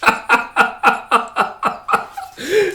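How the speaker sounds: long, high helpless laugh; real laughter. Real, not acted. Reader tickled by the image, laughing. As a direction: keep cracking up.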